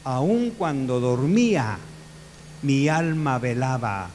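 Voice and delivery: slow, measured scripture recitation; slow solemn quote. Retold or imitated. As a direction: read David's words slowly and solemnly. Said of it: "por eso dice David". (cita lenta y solemne)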